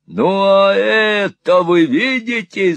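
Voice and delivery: slow, high, loud question; shouting. A narrator's reading. Squeers holds up a glass for Peg, shouting. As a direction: shout it slowly, drawing out the words. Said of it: "заорал Сквирс".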